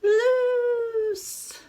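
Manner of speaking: high, loud call left hanging like an echo. Acted. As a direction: let the word ring out down the street. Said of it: "It echoes".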